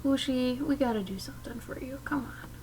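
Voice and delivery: soft, voiceless whisper; whispering to a pet. Real, not acted. Owner whispering softly to her pet, hushed. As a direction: murmur it to the cat.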